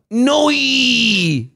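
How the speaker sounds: Australian "Noi." said high with a diphthong, noy; mock Australian "Noi."; Australian accent. Shown as a demonstration. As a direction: drawl "Noi." like an Aussie would say no. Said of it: "That's how they say no".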